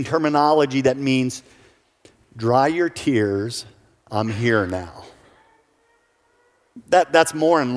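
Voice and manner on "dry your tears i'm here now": gentle, slow consolation; tender comfort. Acted. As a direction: soothe a weeping mother slowly. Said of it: "what would be a much better translation".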